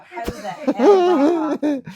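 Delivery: breaking into laughter; laughing disbelief. Real, not acted. Woman telling the interviewer how the night ended, laughing.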